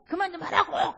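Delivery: put-on high-pitched outburst of a fed-up child; acting a kid snapping back at a nagging mother; sudden yell. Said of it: "소리를 버럭 지르겠지". (버럭 소리 지르는 아이 흉내)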